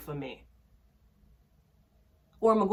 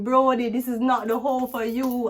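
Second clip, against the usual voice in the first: mock warning; 4 semitones higher, 7 dB louder than usual. Warning a friend about a man's house, mock serious. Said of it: "I try warning".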